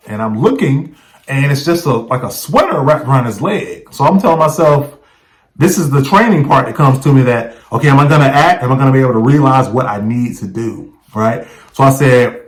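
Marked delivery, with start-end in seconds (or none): none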